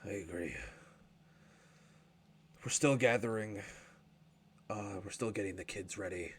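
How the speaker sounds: low, soft, near tears; dwarf father choking up, low and soft. Acted. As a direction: hold back tears, quietly. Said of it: "sort of choking up a little bit".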